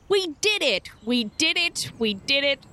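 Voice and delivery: high cheerful cartoon cheer; chirpy kids' cartoon celebration; Dora cheering. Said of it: "As my good friend Dora would say".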